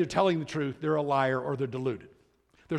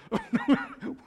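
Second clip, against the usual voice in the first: short laugh; 10 semitones higher than usual. Real, not acted. Laughing at awkward imagined exchange.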